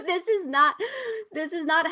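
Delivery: laughing at how he talked. Real, not acted. Laughing to her co-host about the host's odd accent, words breaking into laughter.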